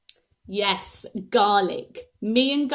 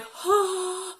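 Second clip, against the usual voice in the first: sharp gasp; 9 semitones higher, 3 dB quieter than usual. Real, not acted. Eating raw garlic cloves.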